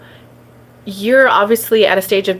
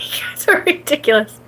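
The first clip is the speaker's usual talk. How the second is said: whispered aside; 6 semitones higher than usual. Real, not acted. Laughing at chat comments.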